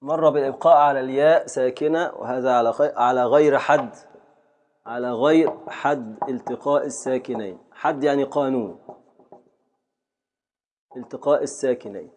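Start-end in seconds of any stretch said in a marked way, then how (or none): none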